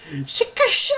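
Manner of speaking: high squeaky flustered outburst; flustered Champa. Retold or imitated. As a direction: squeal it like a flustered cat god. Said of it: "whenever he got flustered and he was just like".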